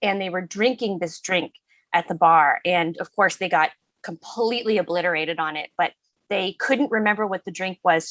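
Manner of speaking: normal talking voice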